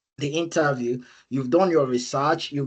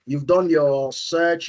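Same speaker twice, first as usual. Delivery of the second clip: normal talking voice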